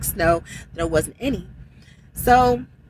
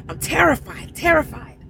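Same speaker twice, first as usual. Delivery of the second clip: retold terror; 7 semitones higher than usual. Reliving terror while jackknifing.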